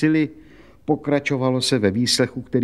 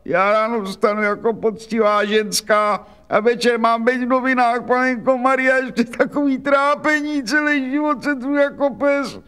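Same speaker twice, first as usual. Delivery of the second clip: put-on much higher, keening complaint of an old washerwoman; wailing lament; 13 semitones higher than usual. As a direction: wail out her hard life, high and plaintive. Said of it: "dala se do řvaní".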